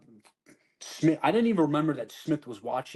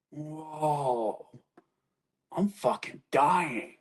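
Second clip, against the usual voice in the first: slow, breaking cry of terror; panicked cry; 3 semitones higher, much slower than usual. Retold or imitated. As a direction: gasp out that you are dying. Retold panic at overdose.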